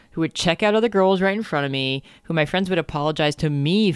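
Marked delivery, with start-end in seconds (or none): none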